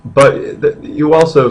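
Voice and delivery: normal talking voice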